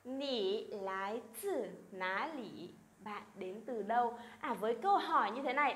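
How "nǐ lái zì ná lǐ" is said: slow, careful Mandarin question; slow, syllable by syllable Chinese demo; slow model reading. Shown as a demonstration. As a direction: read it out slowly for students to copy. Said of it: "cùng đọc lại nhá".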